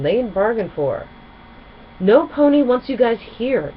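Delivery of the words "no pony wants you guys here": higher, louder angry yell. A narrator's reading. Gilda yelling at the Ponyville crew. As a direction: yell them out of town. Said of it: "Gilda yelled".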